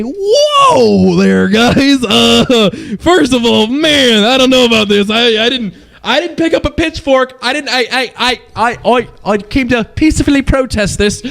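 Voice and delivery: high, loud comic backpedalling, stammering; mock alarmed pleading. Streamer answering the angry mob of players, high stammering mock alarm. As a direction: play a flustered bystander backing away from the mob. Acts out own startled reply.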